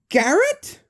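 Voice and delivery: high, rising gasp of a name; disbelieving cry of the name; stunned question; shocked recognition. Acted. Mike recognising his brother.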